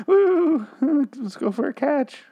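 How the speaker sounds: high, drawn-out fake-happy whoop; hammed-up playful dad calling out; forced cheer. Acts dad forcing cheer.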